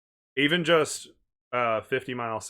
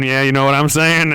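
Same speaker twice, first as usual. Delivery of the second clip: Appalachian accent; 2 semitones higher, 13 dB louder than usual. Shown as a demonstration. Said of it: "start talking like this".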